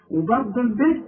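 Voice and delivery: ordinary delivery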